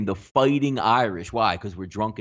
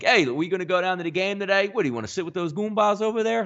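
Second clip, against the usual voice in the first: higher-pitched, chummy call to a pal; friendly banter; 8 semitones higher than usual. Acted. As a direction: toss it out as easygoing ribbing, pitched up. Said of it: "more as a friendly term".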